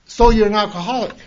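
remembered challenge. Retold or imitated. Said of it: "It's like something just slapped me".

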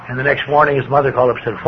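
ordinary delivery

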